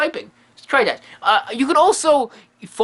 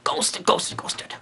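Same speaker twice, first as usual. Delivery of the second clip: whispered, fading to nothing; a secretive whisper trailing off; 7 dB quieter than usual. Shown as a demonstration. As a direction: hush yourself so the ghost won't hear. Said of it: "I'm not supposed to say hello".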